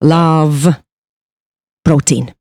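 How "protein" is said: ordinary talk, nothing marked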